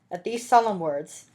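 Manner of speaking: ordinary delivery, nothing marked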